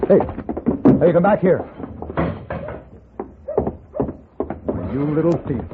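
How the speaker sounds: hey, come back here; shout after thief. Acted. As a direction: yell after the running boy. Calling after fleeing thief.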